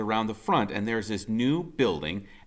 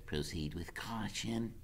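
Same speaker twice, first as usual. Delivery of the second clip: much softer hushed warning; a scout whispering danger; Freak cautioning; 10 dB quieter than usual. A narrator's reading. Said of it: "he says".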